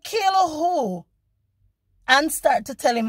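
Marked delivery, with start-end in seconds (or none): 0.0-1.0 high, sharp challenge; defiant shout. Retold or imitated. To a driver who threatened to kill her, a defiant shout. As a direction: throw the threat back at him.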